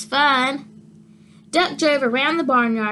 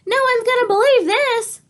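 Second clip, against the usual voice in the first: put-on much higher, eager kid voice; amazed kid; 11 semitones higher, 4 dB louder than usual. The boy thinks about telling friends, much higher and eager. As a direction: bubble over with wonder.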